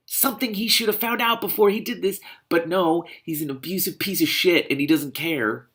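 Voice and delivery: fuming at the character; angry rant. Real, not acted.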